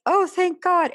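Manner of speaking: high, loud burst of relief; relieved exclamation. Retold or imitated. Rescued daughter to her dad, high relieved cry. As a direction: gasp it out like rescue just arrived. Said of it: "and I'm like".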